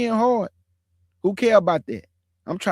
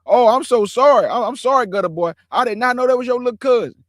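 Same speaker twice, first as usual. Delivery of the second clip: higher and louder, a mock panicked grovel; hammed-up scared apology; 5 semitones higher, 5 dB louder than usual. Teller mimicking the scared boy apologising to him, mock panicked grovel. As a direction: ham up a frightened, grovelling sorry. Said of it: "he got scared".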